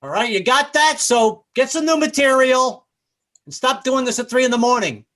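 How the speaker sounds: high, loud fed-up scolding; yelling back. Retold or imitated. Neighbor tells street talker to stop at night, yelling. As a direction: shout the complaint down to the street. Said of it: "I yelled back, I was like".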